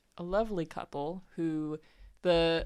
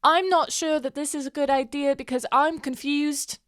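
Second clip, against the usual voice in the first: higher, louder, blurted out; startled confusion; 7 semitones higher, 5 dB louder than usual. Acted. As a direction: snap your head up and blurt it. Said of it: "she's like".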